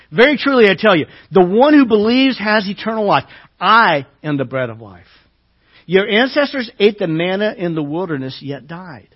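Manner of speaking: loud, measured preaching read; scripture reading. Acted. Preacher reading Jesus' words to the congregation, proclaiming.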